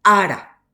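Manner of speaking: teacher models ara to students, loud and clear; clear example word. Shown as a demonstration. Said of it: "por ejemplo".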